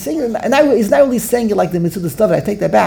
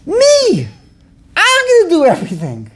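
boastful mock outburst, pitched way up; 9 semitones higher than usual. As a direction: crow it like a show-off. Acting out a boastful David.

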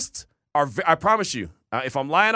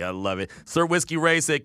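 usual speaking voice

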